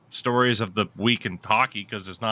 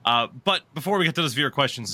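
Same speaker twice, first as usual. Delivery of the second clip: ordinary talk, nothing marked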